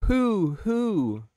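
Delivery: high, hooting owl call. Shown as a demonstration. Streamer showing chat an owl impression, hooting. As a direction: hoot like an owl. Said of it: "Does that sound like an owl?".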